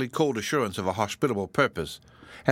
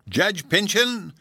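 "Judge Pyncheon" flung back much higher, louder and slow; angry echo; 10 semitones higher, 5 dB louder, much slower than usual. A narrator's reading. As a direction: spit the name back in outrage, falling on "Pyncheon". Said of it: "answered her cousin angrily".

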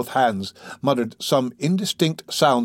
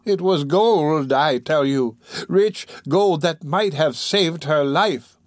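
put-on slow, higher-pitched, insistent old woman; dying woman confessing; 6 semitones higher, slower than usual. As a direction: pour out the guilty secret with slow emphasis.

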